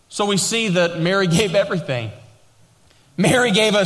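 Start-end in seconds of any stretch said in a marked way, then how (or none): none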